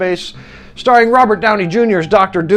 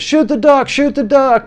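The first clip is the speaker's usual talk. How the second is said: higher, insistent dad's voice; dad's urging; 7 semitones higher than usual. Retold or imitated. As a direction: push the boy to shoot, higher pitch. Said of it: "his dad says".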